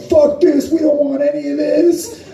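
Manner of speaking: high squeaky quote of an uptight company; high-pitched mock company voice. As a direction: squeak it out like a nervous corporate rep. Company quoted pulling the pedal.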